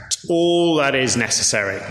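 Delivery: normal talking voice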